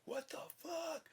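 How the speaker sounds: a cartoonish panicked shriek. Full shock on looking down.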